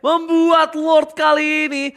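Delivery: loud, hyped-up caster call; fighting game announcer. Acted. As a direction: call the teamfight at full volume. Hype esports play-by-play. (komentator pertandingan yang heboh dan keras)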